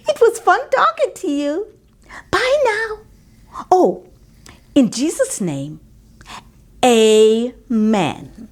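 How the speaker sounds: put-on a child happily ending her prayer; cheery little girl. A narrator's reading. Bobby ends her prayer.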